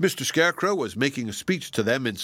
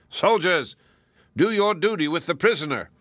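higher, raised solemn order; solemn command; 6 semitones higher than usual. A narrator's reading. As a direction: order the soldiers gravely. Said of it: "said in a solemn voice".

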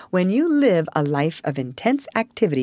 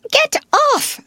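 high, loud spluttered protest; spluttering; 6 dB louder than usual. Acted. Rat fends off Otter's embrace, spluttering. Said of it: "spluttered the Rat with his mouth full".

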